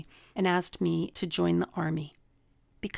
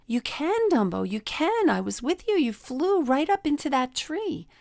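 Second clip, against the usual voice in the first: higher, eager mouse voice, slowing at the end; Timothy the mouse; 6 semitones higher, 3 dB louder than usual. Retold or imitated. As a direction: cheer him on like a little mouse. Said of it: "And the mouse said".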